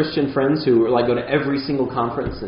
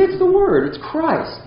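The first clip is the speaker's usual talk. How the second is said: much higher, insistent; pointing to Christ; slower than usual. Retold or imitated. Preacher voices a faithful teacher pointing to Christ, high and fervent. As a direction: lift it up, ringing and sure. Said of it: "He would say".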